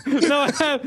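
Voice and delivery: laughing admission. Real, not acted. Lost the game on screen.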